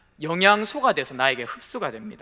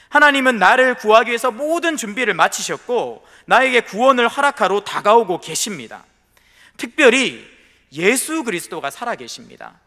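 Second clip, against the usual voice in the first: loud fervent proclamation from the pulpit; preaching at full force; 3 semitones higher, 5 dB louder than usual. Acted. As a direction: preach it out, ringing and strong. (강단에서 힘차게 선포하듯)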